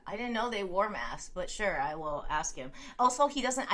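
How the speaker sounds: usual speaking voice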